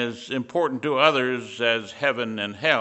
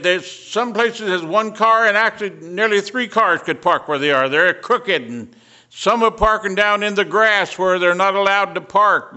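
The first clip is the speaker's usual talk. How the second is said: upset complaint; 6 semitones higher, 4 dB louder than usual. Retold or imitated. Upset maintenance man retold.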